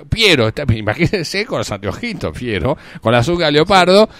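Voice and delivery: normal talking voice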